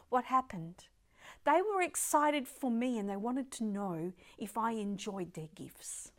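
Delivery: usual speaking voice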